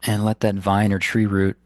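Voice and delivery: unhurried phrases with long, restful pauses; guided meditation. Acted. Meditation teacher guiding listeners to ground the body, in guided meditation style. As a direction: guide them gently inward, leaving space between instructions.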